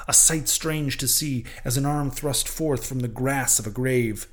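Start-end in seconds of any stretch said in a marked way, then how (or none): none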